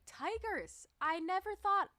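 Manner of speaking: high excited squeal of discovery; delighted high-pitched gasp of a word. Retold or imitated. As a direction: blurt it out like you just spotted it. Excited discovery in thrift store.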